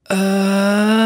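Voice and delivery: breathy cracking high uh; airy breaking high tone. Shown as a demonstration. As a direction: let a high note crack into air. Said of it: "voice keeps breaking into airy sounds".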